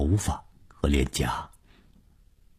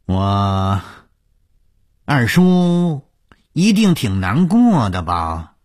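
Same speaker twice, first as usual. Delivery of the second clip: drawn-out, raised, weighty concern; heavy-hearted; 7 semitones higher, 5 dB louder, slower than usual. A narrator's reading. 洪金 asking after his grieving second uncle, heavy-hearted. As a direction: stretch the words slowly with heavy concern. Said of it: "满脸沉重地接着说".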